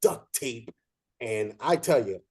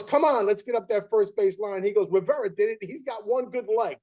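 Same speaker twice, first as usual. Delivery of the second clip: retold instructor scream; 7 semitones higher than usual. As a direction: shout him up the line like a coach. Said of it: "you hear the instructor scream".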